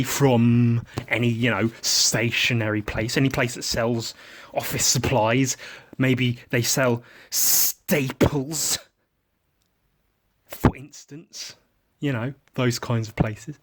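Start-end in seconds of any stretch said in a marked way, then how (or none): none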